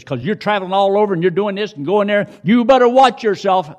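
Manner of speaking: high, loud, fast mock scolding; imitated telling-off. Daughter lectures her traveling father by phone, scolding loudly. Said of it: "my daughter was blessing me out".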